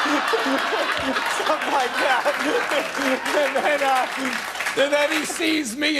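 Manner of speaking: high-pitched laughter breaking through; can't stop laughing at the memory. Real, not acted. Telling the Rickles story, laughing hard.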